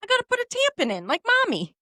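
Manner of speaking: put-on high, sweet toddler voice; little girl voice. Toddler telling mommy she needs a tampon, high and sweet. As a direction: chirp it like an eager little girl.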